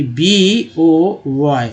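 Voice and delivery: B O Y as slow separate letters, "bee, oh, why"; spelling out. Shown as a demonstration. Teacher writes an example word for learners, slowly spelling it. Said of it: "अगर मैं लिखता हूँ".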